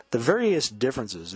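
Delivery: ordinary talk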